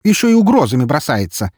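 high, loud indignation; outraged exclamation. A narrator's reading. Neighbour woman reacts to the threat, exclaiming in outrage. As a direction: cry out, scandalised. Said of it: "тут же воскликнула та".

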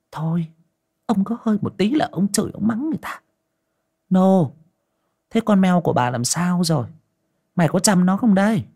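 high-pitched nagging wife; old wife nags her husband, high and quick; scolding wife. Acted. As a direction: nag him in a high voice.

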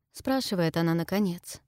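ordinary delivery

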